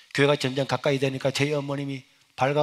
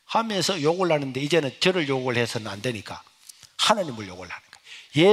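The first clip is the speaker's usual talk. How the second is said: ordinary talk, nothing marked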